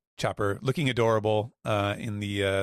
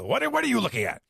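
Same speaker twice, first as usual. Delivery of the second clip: startled snap; 5 dB louder, faster than usual. Retold or imitated. Loki caught, snapping at Gerd.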